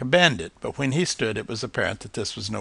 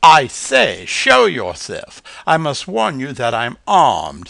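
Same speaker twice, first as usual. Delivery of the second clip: loud, slow, self-important bird voice; pompous bird; 3 semitones higher, 9 dB louder, slower than usual. A narrator's reading. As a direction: puff up like a proud old bird. Reginald's voice in the story.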